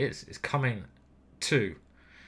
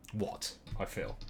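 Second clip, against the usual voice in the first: unvoiced muttered aside, much softer; whispered aside; 5 semitones lower, 11 dB quieter than usual. Real, not acted. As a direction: breathe it under your breath.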